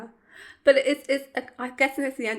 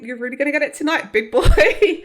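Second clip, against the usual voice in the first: quick, raised quote breaking into laughter; laughing mock tease; 4 semitones higher, 9 dB louder than usual. Host quotes the chief's come-on to Dean, laughing. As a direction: build to a loud, laughing "big boy". Voicing the leering chief, amused.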